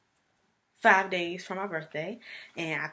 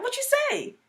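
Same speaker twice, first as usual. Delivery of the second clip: mother's voice. Retold or imitated. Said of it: "She's like".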